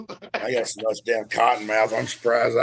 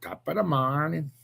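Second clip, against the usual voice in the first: mock Irish accent; 9 dB quieter than usual. Echoing the Irish accent greeting.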